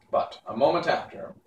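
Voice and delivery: ordinary delivery, nothing marked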